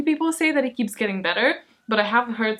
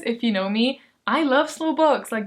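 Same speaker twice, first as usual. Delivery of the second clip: ordinary talk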